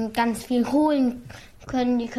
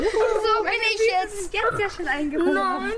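laughing in the cold; 7 semitones higher, faster than usual. Real, not acted. Child barefoot in snow.

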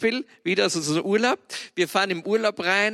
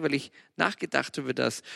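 usual speaking voice

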